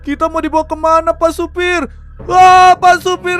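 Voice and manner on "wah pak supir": sudden loud, high yelp; startled shout. Acted. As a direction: yelp as the ghost shows up. Ghost appears in the bus. (teriakan kaget yang keras)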